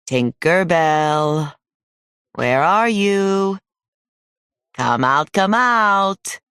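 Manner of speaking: put-on falsely sweet uncle luring a child out; coaxing call. A narrator's reading. Hunter luring the hidden girl out, coaxing.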